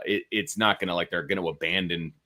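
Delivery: ordinary talk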